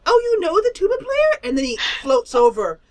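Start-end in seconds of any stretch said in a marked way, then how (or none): 0.1-1.4 squealing delight; thrilled little creature; excited high voice. Acted.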